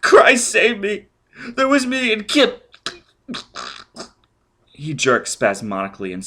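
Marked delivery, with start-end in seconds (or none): 0.0-2.8 much higher, slowed, louder cry; dying man screaming a confession; desperate scream. Acted. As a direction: beg for mercy as he dies. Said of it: "he screamed".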